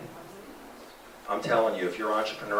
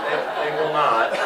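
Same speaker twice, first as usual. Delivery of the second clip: louder, stammering through laughter; laughing through words; 6 semitones higher, 7 dB louder than usual. Real, not acted. To the audience about blue eyes, laughing and louder. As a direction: say it while still laughing.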